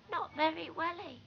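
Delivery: muffled voice. A narrator's reading. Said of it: "in a muffled voice".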